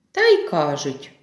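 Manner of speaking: ordinary delivery, nothing marked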